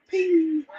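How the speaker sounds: high, soft imitated metal ping; hammer tapping. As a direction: mimic a hammer lightly ringing on metal. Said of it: "tapping on the bomb with a hammer".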